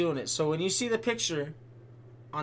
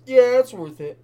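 mock gamer deciding; 6 semitones higher, 9 dB louder than usual. Acting out the angry gamer.

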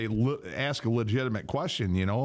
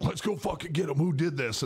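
higher fired-up buddy; ready to fight; 6 semitones higher than usual. Retold or imitated. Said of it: "he's like".

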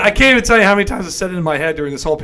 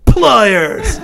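loud, laughing bark of a word; Sid's "Pliers!"; 5 semitones higher, 8 dB louder than usual. Retold or imitated. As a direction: call for the tool like a mad surgeon. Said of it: "I just think in my head".

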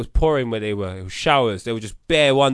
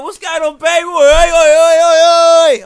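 loud, much higher, rowdy calling out; being stupid; 14 semitones higher, 14 dB louder, slower than usual. Retold or imitated. Cheering on the strippers, in a loud, rowdy, silly voice. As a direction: yell it like a lairy lad at the bar. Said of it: "I was being stupid".

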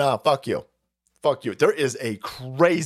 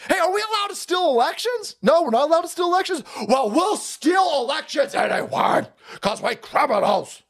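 much higher mock voice, near a shout; mock administration; 11 semitones higher than usual. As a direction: ham up the smug criminals. Mocking administration asking itself.

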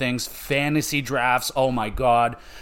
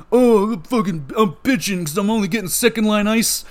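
high, loud mock whine of a spoiled hockey player; whining player; 8 semitones higher, 3 dB louder than usual. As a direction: whine like a pampered star griping about minutes. Player whining about ice time.

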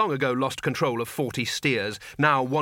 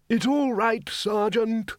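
high, reedy put-on elder; high-pitched gentle reassurance; mild old wizard; 10 semitones higher, much slower than usual. Undead wizard to sergeant, mildly reassuring.

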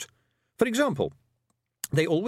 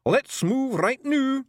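high, drawn-out Scottish order; rally the troops in Scots; urgent Scottish pixie call; urgent command; 14 semitones higher, 4 dB louder, much slower than usual. Acted. Pixie chief urges retreat.

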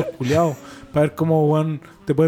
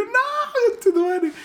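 much higher panicked cry; terrified yelp; slower than usual. Retold or imitated. Waking in the dream to the old woman, panicked cry. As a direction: shriek it in horror. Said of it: "Y yo".

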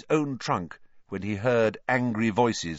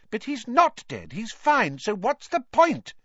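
shrill; 5 dB louder than usual. A narrator's reading. Mother rowing back.

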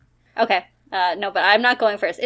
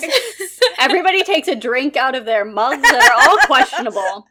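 ordinary talk

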